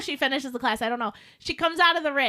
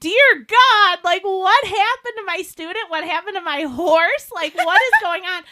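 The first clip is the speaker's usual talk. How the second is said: 1 panicked exclaim; 5 semitones higher, 7 dB louder than usual. Retold or imitated. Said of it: "I'm like".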